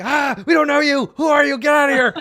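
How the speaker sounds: high-pitched shouted dog voice; barking guard dogs. Acted. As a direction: yell like an excited dog warning off a stranger. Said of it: "They start barking again, and they're like".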